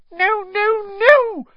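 very high, loud squeak of an elf. Acted. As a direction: squeal the nos in panic. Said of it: "squeaked Dobby".

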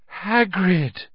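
high-pitched cry of relief; relieved croak. A narrator's reading. Harry spotting his rescuer Hagrid, croaked in relief. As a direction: call out the name, relieved. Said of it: "Harry croaked in relief".

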